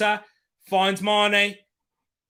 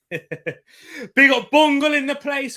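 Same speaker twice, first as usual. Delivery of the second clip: slow, drawn-out hyped shout-out with a laugh; big it up for a mate, loud and stretched; hyped streamer shout-out; 8 semitones higher, 7 dB louder, slower than usual. Real, not acted. Shout-out to a viewer.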